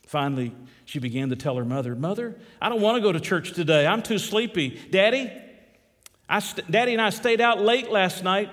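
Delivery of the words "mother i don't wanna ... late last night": put-on higher, pleading teenage excuse; teen daughter whining. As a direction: play the sleepy daughter begging off. Daughter voiced excusing herself.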